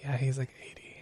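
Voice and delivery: whispered aside. Real, not acted. Friend gossiping about old roommate, whispered. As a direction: hush it like gossip. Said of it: "whispered reply".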